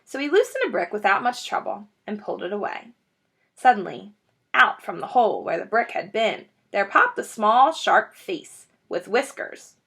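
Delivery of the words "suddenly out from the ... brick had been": ordinary delivery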